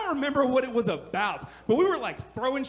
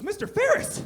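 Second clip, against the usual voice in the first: student voice; 9 semitones higher than usual. Retold or imitated. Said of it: "He goes".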